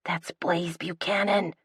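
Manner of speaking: hissed, starstruck whisper; whisper yell. A narrator's reading. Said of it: "Shelby whisper yells".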